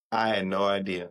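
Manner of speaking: ordinary talk, nothing marked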